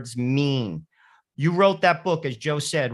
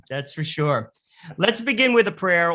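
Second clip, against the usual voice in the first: ordinary talk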